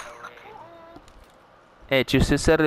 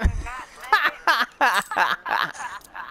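long, high-pitched laughter; cracking up; 6 semitones higher, 4 dB quieter than usual. Real, not acted. Cracking up during game chat.

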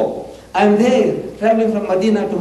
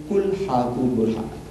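Quran recitation; 5 semitones lower, 6 dB quieter, much faster than usual. Retold or imitated. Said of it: "Allah says".

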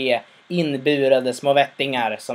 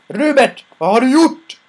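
much higher, louder angry demand; furious father; 11 semitones higher, 8 dB louder than usual. Retold or imitated. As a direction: confront the son in outrage.

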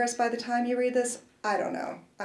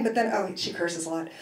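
usual speaking voice

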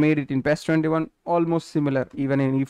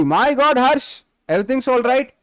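higher and louder, worried for a friend; alarmed concern; 9 semitones higher, 4 dB louder than usual. Real, not acted. Streamer reacting to earthquake news, asking his friend if he's okay, alarmed. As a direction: check on him urgently, voice lifted.

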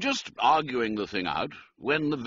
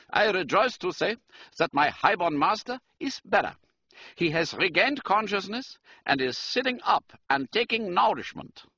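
higher-pitched German count; German-accented aristocrat, pitched up; 11 semitones higher than usual. A narrator's reading. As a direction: address him formally in a German accent. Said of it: "He spoke with a German accent".

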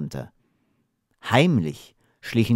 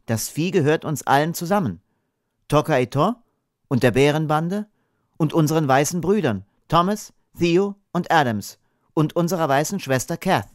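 put-on high, earnest young voice; lightly voiced boy, pitched up; earnest boy Hapeda; 6 semitones higher than usual. A narrator's reading. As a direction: list them all with innocent conviction. Hapeda explains shared ownership.

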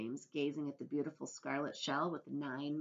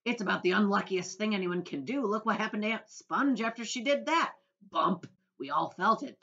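a louder, gleeful gloat; louder, gloating centipede; 5 semitones higher, 10 dB louder than usual. A narrator's reading. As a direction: crow over Aunt Sponge's fate. Centipede gloats about Aunt Sponge.

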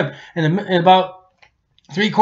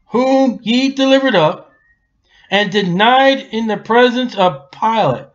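preaching; 5 semitones higher than usual. Acted. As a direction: ring it out as an accusation. Preacher reading scripture aloud.

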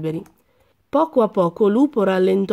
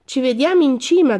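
higher, louder call from ahead; 6 semitones higher, 4 dB louder than usual. A narrator's reading. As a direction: shout it back while running off. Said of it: "gridò". (lo grida allegro correndo via)

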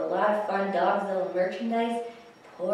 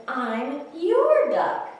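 high, slow, stretched declaration; duck's declaration; 6 semitones higher, 5 dB louder, much slower than usual. Acted. Duck tells the alligator, slow high declaration. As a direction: announce it slowly, lifting high and loud.

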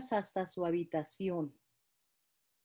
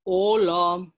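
louder, wolfish growl of a greeting; 2 semitones lower, 8 dB louder than usual. Acted. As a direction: greet her as the sly wolf. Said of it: "dijo el lobo".

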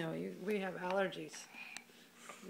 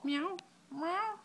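rising, higher kitten meows; kitty meows; 10 semitones higher, much slower than usual. Pretend. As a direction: meow like a playful kitten. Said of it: "like a kitty cat".

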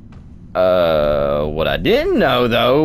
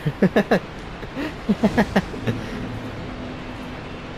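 breathless fake laugh; 12 semitones higher, 6 dB quieter than usual. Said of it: "see the acting?".

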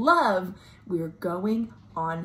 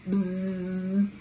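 bee buzz; 2 semitones lower than usual. Acted. Imitating bees.

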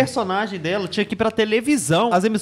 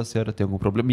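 much lower, calm scammer impression; imitating calm deep voice; 11 semitones lower, 4 dB quieter, much faster than usual. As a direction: mimic his calm, low voice. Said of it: "ele fala com uma calma".

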